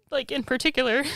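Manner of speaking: normal talking voice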